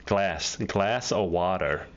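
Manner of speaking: slow New York "Glass of water." with a flat a and an r at the end; slow New York accent. Shown as a demonstration. Host slowly models the New York phrase for the guest. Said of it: "That's a very New Yorkan thing".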